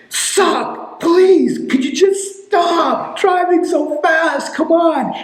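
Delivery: put-on a scared kid pleading from the back seat; frightened plea. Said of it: "he was literally frightened".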